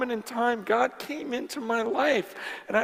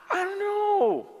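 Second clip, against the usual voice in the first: higher-pitched exasperated pleading; exasperated protest; 4 semitones higher, 3 dB louder, faster than usual. Retold or imitated. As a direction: act out a man fed up with questions. Healed man answering his questioners.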